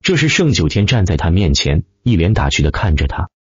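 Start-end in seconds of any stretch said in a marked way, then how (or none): none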